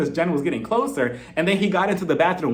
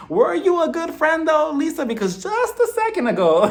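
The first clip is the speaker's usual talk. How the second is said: sarcastic challenge; 10 semitones higher than usual. Retold or imitated. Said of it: "And I'm like".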